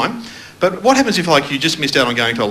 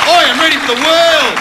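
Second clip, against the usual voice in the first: mock fired-up; 11 semitones higher, 5 dB louder than usual. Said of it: "I feel like getting up".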